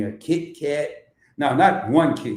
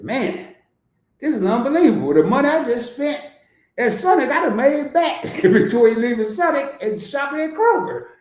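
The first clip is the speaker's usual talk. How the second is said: amazed self-quote; 9 semitones higher, 3 dB louder than usual. Retold or imitated. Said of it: "I said".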